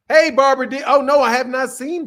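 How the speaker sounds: excited greeting. Real, not acted.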